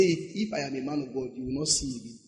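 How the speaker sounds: low, hushed mock curse; quiet pronouncement. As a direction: pronounce judgement softly and with certainty. Retold man of God pronounces judgement.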